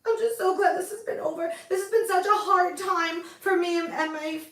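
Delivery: hammed-up weepy thank-you with a choked laugh; fake-cry through the relief, overdone; mock tearful. Host thanking fans after being cleared, hammed-up weepy. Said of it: "I hate being emotional".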